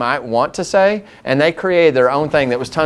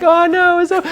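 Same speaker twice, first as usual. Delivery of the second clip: excited; 4 dB louder than usual. Retold or imitated. Joyful exchange with drive-thru lady.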